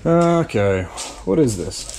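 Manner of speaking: ordinary talk, nothing marked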